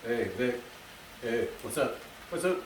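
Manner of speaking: put-on low greeting, playful; lightly faked deep voice. Shown as a demonstration. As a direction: drop the voice a little for a casual hello. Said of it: "I like to lower my voice".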